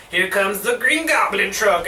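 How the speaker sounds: usual speaking voice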